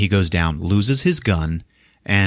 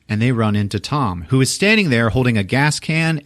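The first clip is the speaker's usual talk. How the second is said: ordinary talk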